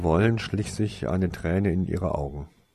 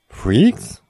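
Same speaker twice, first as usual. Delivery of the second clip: loud, high, outraged question; indignant echo rising sharply in pitch; 7 semitones higher, 9 dB louder than usual. A narrator's reading. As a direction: throw it back, affronted. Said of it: "fragte sie empört".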